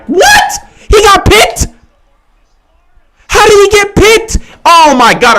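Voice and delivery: loud, high-pitched disbelief; stunned fan yelling at the game; real shocked outburst. Real, not acted. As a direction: shriek in shock at a disastrous play. Reacting live to an interception.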